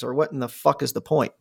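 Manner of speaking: ordinary talk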